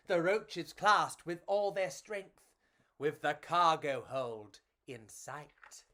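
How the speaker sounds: put-on lively read-aloud for children; storybook narration. A narrator's reading. Reading a picture book to children, lively storytelling. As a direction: tell it like a bedtime picture book.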